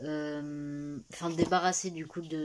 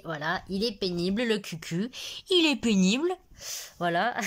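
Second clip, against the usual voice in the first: éclat fort et aigu d'agacement; s'exclame contre le lapin pénible, plus fort et plus aigu; grondant le lapin; 4 semitones higher, 8 dB louder than usual. Real, not acted. Gronde son lapin sur le lit. (gronde le lapin sur le lit, fort et aigu)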